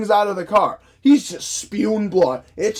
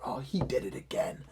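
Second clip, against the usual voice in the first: hushed whisper of a departing moviegoer; whispered quote; 5 semitones lower, 13 dB quieter than usual. Retold or imitated. Imitating moviegoers leaving the theater, in a whisper. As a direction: breathe it out like gossip in the aisle. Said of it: "I hear people walking out there going".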